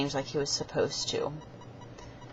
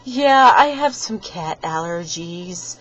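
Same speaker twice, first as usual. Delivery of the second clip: slow, louder mock-sniffly friend voice; imitating Dakota; 2 semitones higher, 8 dB louder, slower than usual. Dakota explaining his cat allergy, slow mock voice. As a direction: play the friend, dragging it out. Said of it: "And he's like".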